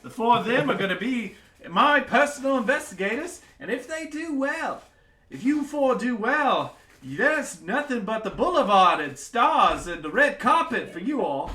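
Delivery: a giddy king booming his decree; excited king. Acted. King naming the party his deputies, booming high.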